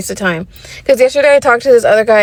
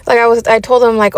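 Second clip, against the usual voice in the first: ordinary talk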